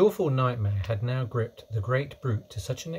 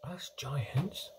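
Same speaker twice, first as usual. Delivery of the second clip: soft, breath-only whisper; 3 semitones higher, 8 dB quieter than usual. A narrator's reading. BFG explaining to Sophie near the sleeper, whispering. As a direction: whisper it as a confidence. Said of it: "the B F G whispered".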